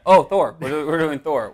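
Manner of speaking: loud, high burst of remembering; sudden realization. Real, not acted. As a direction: blurt it out as it suddenly comes back to you. Suddenly remembers next week's film.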